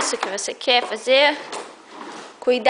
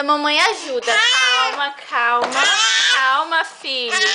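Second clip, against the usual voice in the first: normal talking voice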